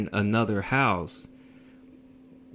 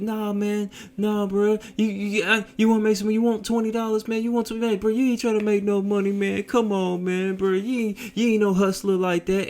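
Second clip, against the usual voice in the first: high, whining imitation of tearful pestering; crying plea; 11 semitones higher than usual. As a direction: mimic a crying man pleading in a run-on stream. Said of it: "And he's crying".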